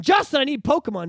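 mock whine, high and loud; whining kid. Hammed-up whining kid to his friend down the street. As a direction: plead like a kid who wants a game. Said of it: "I'm whining to Justin".

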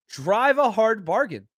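"Drive a hard bargain" drawn out slowly, each word spaced; slow repeat. Acted. Host repeats his answer to the chatter, slowed right down.

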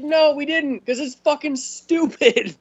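high mocking answer to the traitor; mock exasperated reply.

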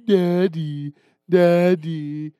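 put-on a touch of a teasing toddler; imitating a toddler. Dad imitates his daughter teasing him. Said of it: "And then she".

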